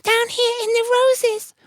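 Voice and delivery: very high, small ant voice; tiny little voice. Acted. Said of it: "this tiny little voice".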